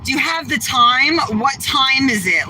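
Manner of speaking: mimicking a rude guy demanding the time, high and pushy; mock him as bossy and entitled. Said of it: "Like, all bossy".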